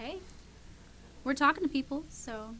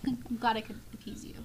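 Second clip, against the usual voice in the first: whispering; 6 dB quieter than usual. Real, not acted. Laughing aside to chat.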